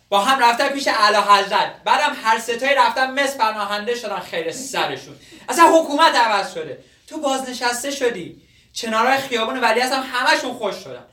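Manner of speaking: loud, fed-up insistence; exasperated outburst. Acted. Student tells old man the mayor is gone, exasperated. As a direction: burst out in frustration, pleading to be left alone.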